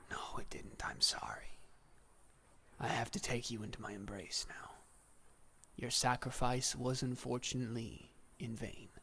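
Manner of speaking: soft, whispery reaper's voice; hushed, regretful Death; sorrowful, solemn Death. Acted. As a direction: whisper it gently, like Death breaking bad news. Voices Death consoling the god.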